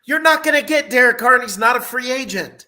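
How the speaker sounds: no way, push back hard; emphatic objection. Real, not acted. Heated debate over Derek Carr.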